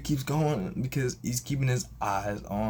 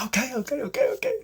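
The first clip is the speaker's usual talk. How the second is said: rising, high repeated agreement; flustered excitement; 5 dB louder, much faster than usual. Retold or imitated. Caller realising they share a struggle, excited high reaction. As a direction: climb higher with each word, mildly hammed-up.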